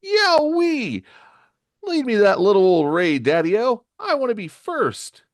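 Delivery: high, loud excited whoop. A narrator's reading. Johnny whooping to be first under the ray, excited. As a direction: shout it eagerly, voice leaping way up. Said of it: "flames on in excitement".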